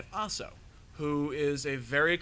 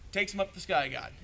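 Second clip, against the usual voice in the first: ordinary delivery, nothing marked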